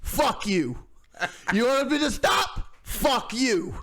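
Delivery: shouty caricature of the band member; angry metal singer. Retold or imitated. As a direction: bark it at the interviewer like a furious rocker. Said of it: "The guy's like".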